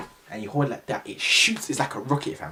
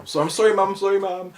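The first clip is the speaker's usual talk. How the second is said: apologising to mom; 8 semitones higher, 6 dB louder than usual. Real, not acted. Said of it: "my mom's sleeping".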